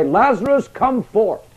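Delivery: high, ringing call to the dead. Acted. Jesus before the tomb calling his friend Lazarus, a high ringing call. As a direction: summon him out of the tomb.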